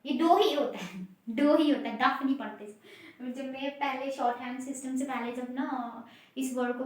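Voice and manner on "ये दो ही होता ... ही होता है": ही punched loud in दो ही होता है; stress on ही. Shown as a demonstration. Teacher insisting the word is read दो, stressing ही. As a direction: lean hard on ही after दो. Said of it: "ये दो नहीं होता".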